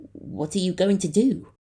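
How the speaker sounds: high, loud brave question. A narrator's reading. Captain bravely asking the Guardian, high and loud. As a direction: ask it bravely despite the fear. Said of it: "he bravely asked".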